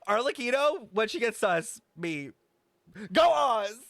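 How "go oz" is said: ordinary delivery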